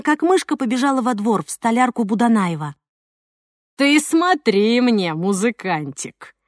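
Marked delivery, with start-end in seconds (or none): 3.8-6.3 slow, sinister bully warning; ominous threat. Acted. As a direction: draw it out slowly, menacing. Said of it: "зловеще произнёс Жох".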